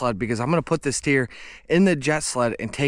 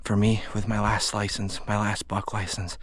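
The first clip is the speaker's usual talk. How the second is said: ordinary talk, nothing marked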